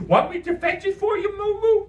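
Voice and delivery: very high, loud cartoon voice; silly doting friend. Imaginary friend offers to fetch it for Martin, silly doting voice. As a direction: coo a zany offer to a little pal.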